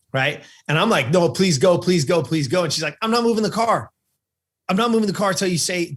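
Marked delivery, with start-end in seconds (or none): 1.1-2.6 retold plea, pitched a bit higher; urgent pleading. Boy begging mom to drive off, urgent retold pleading. 3.0-5.9 higher-pitched imitation of a refusing mother; insistent mom. Mom refusing to drive until told what happened, insistent.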